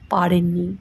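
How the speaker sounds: ordinary delivery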